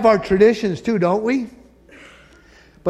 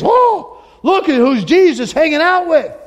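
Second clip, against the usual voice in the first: high, louder scandalised sneer; mocking Pharisee; 11 semitones higher, 6 dB louder than usual. Pharisees gossiping about Jesus, scandalised high sneer.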